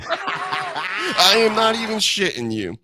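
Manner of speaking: high laughing disbelief; laughing and slow, not kidding. Real, not acted. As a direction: laugh through the line, slow. Laughing at own mistake.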